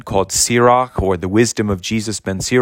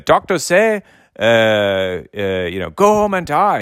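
put-on Gerson's German accent. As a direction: mimic a German doctor mocking the other doctors' verdict. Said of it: "he would say".

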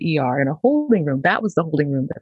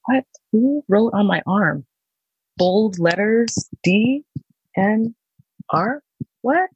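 upset; 6 semitones higher, slower than usual. Retold or imitated. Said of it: "And I got upset".

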